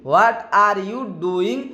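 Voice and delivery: very slow, every word drawn out; teacher's slow demo of "What are you doing?"; slow model phrase. Shown as a demonstration. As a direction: spell it out slowly for learners. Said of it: "इस प्रकार बोलेंगे".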